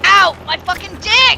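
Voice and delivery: sudden loud yelp of pain; pained yell. Real, not acted. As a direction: yell out like you're hit. Hit in game, yelling in pain.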